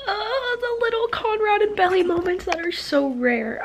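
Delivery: high, gushing fangirl voice; gushing squeal. Real, not acted. Fan gushing to viewers about cute scenes, high squeal. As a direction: swoon over the moment. Gushing over rare romantic moments.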